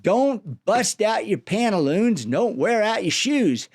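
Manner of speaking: plain reading voice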